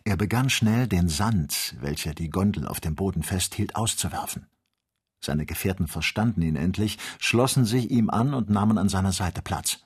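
ordinary delivery, nothing marked

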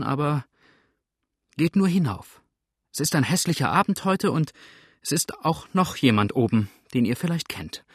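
flustered, halting consent; trembling, feigning grumpiness. Acted. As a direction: give in uneasily, covering it with crossness. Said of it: "mit zitternder Stimme".

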